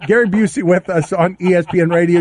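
usual speaking voice

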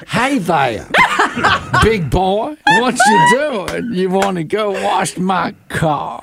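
high, slow Southern come-on; Thelma's Southern sexy voice. Acted. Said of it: "That's Thelma's sexy voice".

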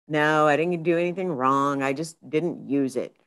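ordinary talk, nothing marked

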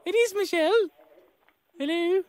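very high put-on woman's voice; badly faked wife on the phone; Michelle. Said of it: "Doesn't sound like Michelle".

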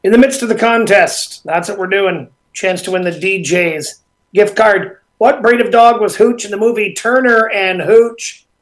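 loud, upbeat radio host read; radio host announcer. Acted. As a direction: pump up the show return. Radio host back from break.

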